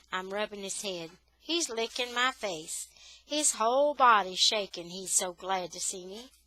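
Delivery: plain reading voice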